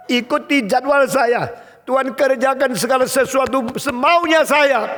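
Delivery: high, loud orders to God; acted bossy shouting; demanding prayer. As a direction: command insistently at full voice. Acted believer ordering God around.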